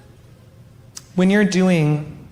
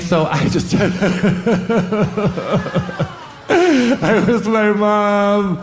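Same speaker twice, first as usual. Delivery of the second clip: high, hammed-up crying wail; mock sobbing; 9 semitones higher, much slower than usual. Comic acting out a panicked rehab patient, mock sobbing. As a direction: break into stuttering fake sobs, then wail the words slowly.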